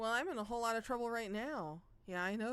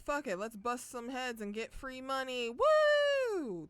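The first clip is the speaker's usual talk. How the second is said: mock cocky whoop; 4 semitones higher, 6 dB louder, slower than usual. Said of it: "Kiryu was like".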